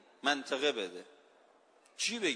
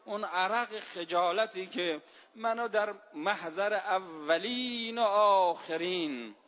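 ordinary talk

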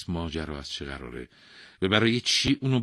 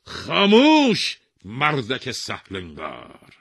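slow, loud, high furious shout; furious king silencing the mat weaver; angry shout; 6 semitones higher, 11 dB louder, much slower than usual. Acted. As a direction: roar him into silence. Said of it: "با عصبانیت فریاد زد".

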